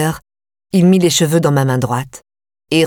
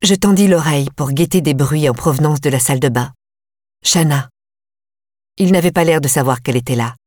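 usual speaking voice

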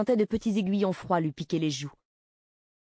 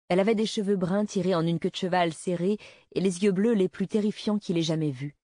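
ordinary delivery, nothing marked

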